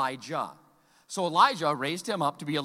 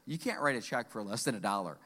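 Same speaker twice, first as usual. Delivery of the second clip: stern cashier; 10 semitones lower, 7 dB quieter than usual. Retold or imitated. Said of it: "She says".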